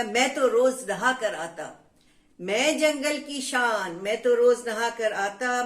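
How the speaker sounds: loud, chanted boast of a proud elephant; angry boastful elephant. Acted. Teacher voicing the angry elephant for students, loud proud boast. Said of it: "हाथी भी गुस्से में आ गया".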